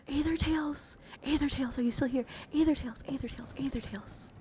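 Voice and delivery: high, loud, repeated name-calling; excited calling out. Real, not acted. Streamer checking a viewer is still here, calling out excitedly. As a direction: call the name over and over, eagerly.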